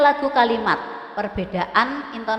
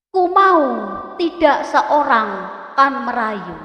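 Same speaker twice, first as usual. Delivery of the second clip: poem recitation; 2 semitones higher, 4 dB louder than usual. Shown as a demonstration. Teacher reciting a poem to pupils, dramatic and loud. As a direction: declaim each line with full force. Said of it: "Mari kita lihat cuplikan puisi".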